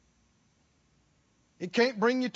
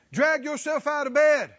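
normal talking voice

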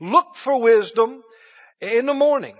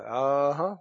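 low, soft, smiling "uh-huh"; a quiet, privately pleased realization; knowing smile; 7 semitones lower, 6 dB quieter than usual. Acted. Jesus thinking as the answer comes, low knowing amusement. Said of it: "he got a big smile and he thought".

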